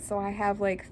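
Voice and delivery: ordinary talk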